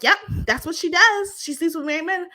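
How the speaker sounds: high-pitched imitation of a taunting accuser; mock accusing. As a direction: chime in as the friend telling on her. Retold taunt of Mia accusing Jackie.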